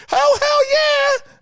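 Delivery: high, loud, slow joyful whoop; thrilled. Retold or imitated. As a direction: stretch out a delighted hell yeah. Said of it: "And I was like".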